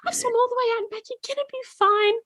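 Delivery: put-on high, soothing old lady voice; kindly old woman. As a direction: reassure like a sweet grandma. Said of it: "she's like, like".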